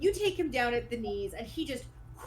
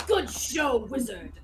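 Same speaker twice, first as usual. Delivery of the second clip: grand praise with bursts of volume; hearty skeleton knight; 4 dB louder than usual. Acted. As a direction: congratulate like a pompous old-world knight. Game master voices hearty skeleton knight.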